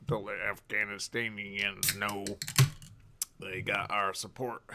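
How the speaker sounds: slow, ominous mock announcer; drawn-out conspiratorial tone, half joking; a light parody of a propaganda broadcast.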